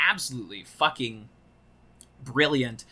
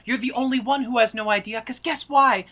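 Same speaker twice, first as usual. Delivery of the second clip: high, fast mock taunt; acted taunt; 9 semitones higher, 7 dB louder than usual. Cohost reciting the insult monologue, high fast taunt. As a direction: taunt quickly in a raised voice.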